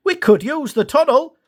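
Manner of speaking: a high eager little engine; Dash suggesting. A narrator's reading. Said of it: "Dash suggested".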